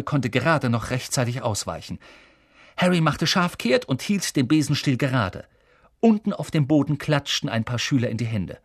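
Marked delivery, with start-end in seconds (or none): none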